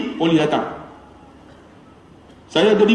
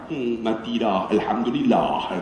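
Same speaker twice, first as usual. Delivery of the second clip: slow, low, quiet mock relief; hushed, drawn-out thanks under the breath; muttered behind backs; 11 semitones lower, 7 dB quieter, slower than usual. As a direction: murmur it privately, unmoved. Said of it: "orang buat belakang".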